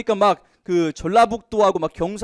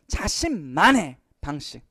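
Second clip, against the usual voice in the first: slow, deliberate phrase for students; teacher dwelling on 자신만의 방식 slowly; slow emphatic reading; 3 semitones lower, slower than usual. Shown as a demonstration. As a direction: stretch each syllable to make it stick. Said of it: "이런 거 잘 봐야 돼요".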